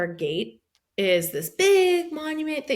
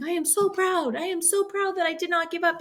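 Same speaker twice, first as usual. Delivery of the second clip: higher, mildly tearful retelling; tearful pride; 7 semitones higher than usual. Retold or imitated. Runner recalling her big moment, mildly tearful pride. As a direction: lightly act out sobbing with pride. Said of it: "I'm sobbing".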